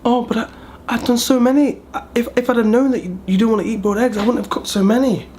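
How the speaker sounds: very high whiny mock mother-in-law; mother-in-law upset about the extra eggs, mimicked high; wounded mother-in-law. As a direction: pitch it way up, fast and hurt. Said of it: "she's like".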